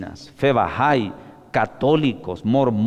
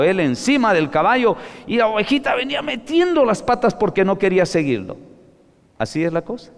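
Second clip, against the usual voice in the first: ordinary talk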